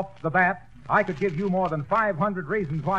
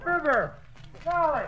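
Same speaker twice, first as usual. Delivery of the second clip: higher, rising alarmed calls; muffled panic; 8 semitones higher than usual. Acted. Wilcox calling for help with a lantern over his head. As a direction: call out blindly, growing frantic.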